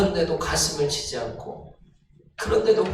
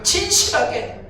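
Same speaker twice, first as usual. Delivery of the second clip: high, loud, surging sermon voice; fiery preaching; 8 semitones higher, 4 dB louder, slower than usual. Acted. Pastor building the congregation to fervor, fiery preaching. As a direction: preach it with everything, rising.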